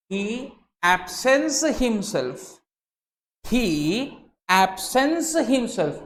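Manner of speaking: absents drawn out slow and high, falling; stress on absents. Shown as a demonstration. Lecturer stressing absents for students, slow. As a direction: lean hard on absents, slowly. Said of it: "focus on these three words".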